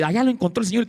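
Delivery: normal talking voice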